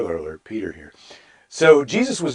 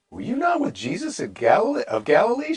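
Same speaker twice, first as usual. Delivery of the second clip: much higher-pitched questioning voice; servant girl; 10 semitones higher than usual. Acted. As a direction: voice a servant girl in a high register.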